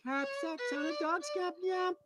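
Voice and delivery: sung phonetically in reverse; backwards speech. Shown as a demonstration. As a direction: sing the song backwards. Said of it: "perform pack rack backwards".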